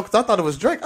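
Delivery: normal talking voice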